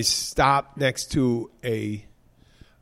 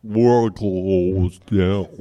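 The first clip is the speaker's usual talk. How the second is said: very slow, low, dragged-out mascot voice; moose winding down; 6 semitones lower, much slower than usual. Retold or imitated. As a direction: stretch every word like a dying recording. Moose winds down after being punched.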